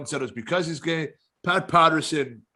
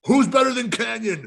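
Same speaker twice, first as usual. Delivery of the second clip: high, loud wrestler promo yell; Kanyon catchphrase; 8 semitones higher, 4 dB louder than usual. Retold or imitated. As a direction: hype it up like a ring promo. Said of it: "all he said was".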